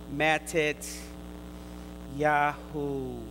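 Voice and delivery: "Matet Yahu" said as a Hebrew name, two calm syllable groups. Shown as a demonstration. Preacher giving Matthew's Hebrew name, calm Hebrew pronunciation. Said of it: "or as we say in the Hebrew".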